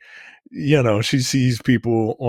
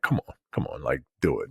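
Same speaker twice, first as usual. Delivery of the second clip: soft coaxing murmur, much softer; low, hushed egging on; quiet coaxing sister; egging on quietly; 8 semitones lower, 8 dB quieter, faster than usual. Retold or imitated. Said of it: "the sister's egging her on".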